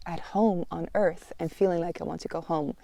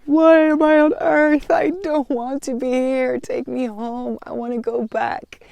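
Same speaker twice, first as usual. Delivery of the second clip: high, pleading cry to go home, tearful and loud at first; retold sobbing plea; 5 semitones higher, 3 dB louder than usual. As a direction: beg to be taken home through tears, then fading. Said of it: "sobbing, sobbing".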